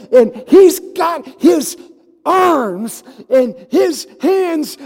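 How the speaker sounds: loud, high, slow preacher's shout; full force preaching. Acted. Preacher rousing his congregation, full-force preaching shout. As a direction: drive it home to the congregation.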